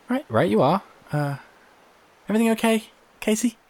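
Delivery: high, bright-pitched shopkeeper calling out; cheery high landlady voice calling across the street; Jeannie calling out. Acted.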